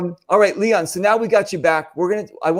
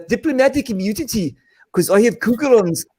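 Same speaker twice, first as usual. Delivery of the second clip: higher-pitched mock South African movie villain accent; hammed-up, badly faked South African accent; 5 semitones higher than usual. Host quoting a Lethal Weapon line, bad South African accent. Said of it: "that's honestly the worst accent".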